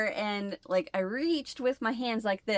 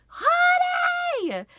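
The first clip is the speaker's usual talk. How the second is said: high, loud alarmed call; shocked shout; 6 dB louder than usual. Retold or imitated. Wife calling her husband in the garage, shocked shout. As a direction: yell for help with real alarm. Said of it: "the shock in my voice".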